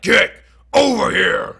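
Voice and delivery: loud shout broken after GET; mock halting delivery. Vlogger showing how he didn't say it, halting mock shout. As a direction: shout GET, stop, then shout OVER HERE. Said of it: "he wasn't like".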